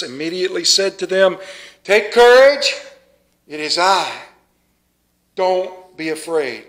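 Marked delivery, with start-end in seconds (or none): none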